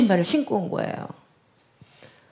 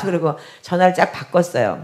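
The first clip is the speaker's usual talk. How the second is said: ordinary delivery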